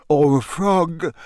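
slow sleepy yawn through words. A narrator's reading. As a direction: say it mid-yawn. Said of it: "yawned Harry".

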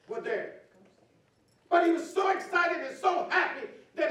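high, loud, excited preach; fervent preaching. Acted. Pastor telling the church how David danced, shouting with joy.

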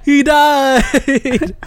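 high mock wail through laughter; re-enacted half-laughing cry; laughing dismay. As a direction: lament it while cracking up. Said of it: "I was, like, kinda laughing".

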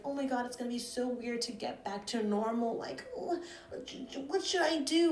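high, squeaky mock of dramatic people; mocking whiny voice. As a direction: parody their panic in a silly high voice. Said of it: "Everyone's like".

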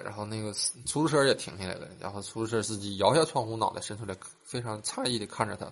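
normal talking voice

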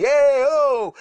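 high, loud hey-oh burst; excited owner greeting. Retold or imitated. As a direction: shout a delighted welcome across the room. Owner happy to see him.

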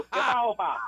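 mock rural Puerto Rican accent. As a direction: fake a rough Puerto Rican jíbaro accent answering the trooper. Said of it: "Con ese acento".

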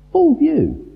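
"Full" lifted high and hammered hard; a high emphatic "Full" echoing the phrase; stress on full view. Shown as a demonstration.

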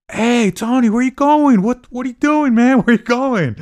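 high, loud calling after someone down the street; mock friends shouting Tony's name from behind. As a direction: yell after a buddy walking away. Drunk friends calling after Tony.

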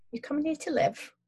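friendly London cabbie asking; Cockney cabbie. Acted. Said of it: "Cockney accent".

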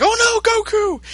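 high, alarmed cry; dismayed gasp. Retold or imitated. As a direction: gasp as if your hero just fell. Everyone reacting to Goku shot.

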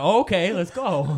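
slow, high put-on compliance; mocking imitation of eager consent; mock agreeing. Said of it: "he's like".

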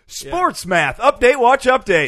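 loud, high mock-announcer call; big hyped-up segment intro. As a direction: punch it like a show stinger.

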